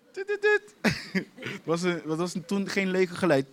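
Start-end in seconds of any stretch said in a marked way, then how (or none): 0.1-0.7 high clicky tut tut tut; playful mimicked tutting; mock tutting noise. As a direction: click out a little tutting sound. Imitating a sound effect.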